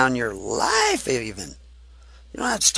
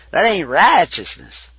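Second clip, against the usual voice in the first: emphatic southern preacher's accent, raised pitch; 4 semitones higher, 6 dB louder than usual. Shown as a demonstration. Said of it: "That's my southern accent".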